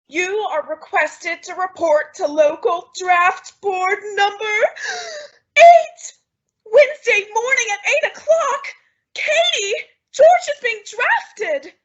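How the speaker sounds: building from plain reading to high, loud panic; rising alarm. Acted. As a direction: read on calmly, then shoot up in shock at "eight". Reading draft notice, growing alarm.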